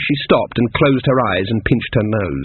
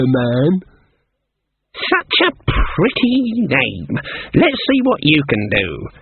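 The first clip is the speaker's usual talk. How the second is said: higher, slow mocking crone; sizing up three girls with scorn; old witch drawing out each word mockingly; sardonic old witch; 5 semitones higher, slower than usual. Granny tests the girls.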